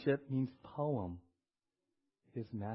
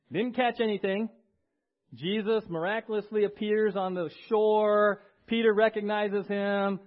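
lightly raised storytelling sermon voice; a louder, higher preaching narration; 6 semitones higher, 8 dB louder than usual. Acted. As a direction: tell the story with lifted energy. Storytelling the scene.

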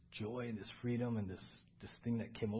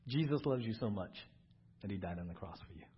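ordinary talk

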